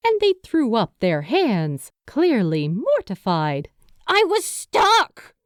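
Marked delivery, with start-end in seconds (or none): 4.1-5.3 high, slow and tight; protest, voice tightening; defensive. A narrator's reading. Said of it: "they countered defensively".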